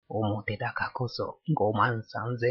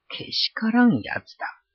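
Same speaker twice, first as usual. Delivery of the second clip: high, sharp indignant exclamation; indignant outburst; 11 semitones higher, 3 dB louder than usual. A narrator's reading. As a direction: spit out the insult in disgust. Kira mutters angrily.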